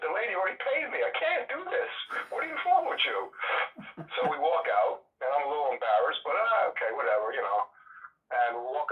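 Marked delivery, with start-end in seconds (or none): 0.0-3.3 high, incredulous mock saleswoman; hammed-up disbelief from the shop lady; exasperated shop lady. Saleswoman fed up with the date's haggling, high and incredulous.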